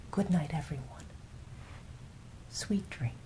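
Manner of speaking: soft whispered good nights; bedtime whisper. Real, not acted. Saying good night to the listeners, whispered softly. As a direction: whisper each name as they drift off.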